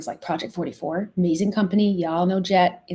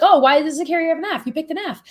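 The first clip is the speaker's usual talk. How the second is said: higher-pitched mock questioning of a user; imitated incredulous customers; 9 semitones higher, 6 dB louder, faster than usual. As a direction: voice an imagined complainer, pitched up. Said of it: "they're gonna be like".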